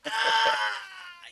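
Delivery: high, loud, drawn-out "ah"; mock horrified scream. Teenager hearing her mom said okay, mock horrified scream. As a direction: scream in playful horror. Retells horror at his news.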